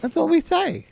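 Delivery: high-pitched indignant defence; defensive protest. Real, not acted. Defends hometown pronunciation in protest.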